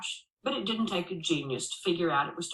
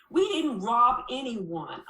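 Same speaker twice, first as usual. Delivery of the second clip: firm, slow and a bit louder; bold denial; 6 semitones higher, 6 dB louder, slower than usual. Retold or imitated. Girl boldly denying the robbery to the sheriff. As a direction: insist on it, unafraid. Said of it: "I boldly contend".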